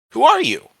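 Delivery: high, loud startled question; alarmed demand. A narrator's reading. Boy to a voice he cannot see, alarmed. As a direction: call out to the unseen speaker.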